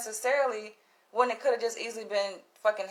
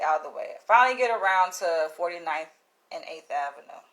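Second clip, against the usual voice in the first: normal talking voice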